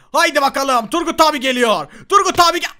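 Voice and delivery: loud, high, rising excitement; hyped-up battle call. Pretend. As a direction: announce yourself as you charge in. Oyunda kendini anons ederek atlıyor. (coşkuyla, yüksek sesle haykırma)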